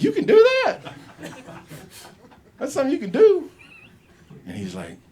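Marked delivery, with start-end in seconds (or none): 0.0-3.5 much higher, louder, laughing disbelief; amazed disbelief. Retold or imitated. Boy astonished by dad's confession, high and laughing. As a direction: squeal it in laughing shock.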